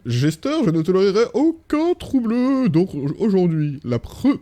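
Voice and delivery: loud, high, stretched-out mock French-accented voice; hammed-up French accent. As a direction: declaim slowly like an over-the-top Frenchman. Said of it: "l'accent français, incroyable".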